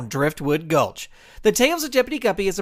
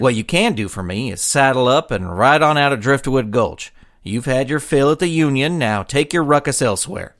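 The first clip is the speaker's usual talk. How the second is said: a lightly voiced deputy, lower and firm; firm lawman deputy; 8 semitones lower, 4 dB louder than usual. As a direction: stand your ground and tell them to leave. Deputy confronts the gang leader.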